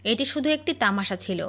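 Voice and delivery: usual speaking voice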